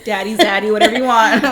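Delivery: blurting it out through laughter; laughing loudly. Real, not acted. Cohost teasing about their crush, laughing loudly.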